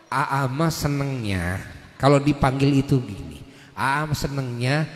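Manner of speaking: low, soft, unhurried husband voice; acted tender request, pitched low; gentle husband coaching. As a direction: tell your wife softly what you like. Husband gently coaching his wife.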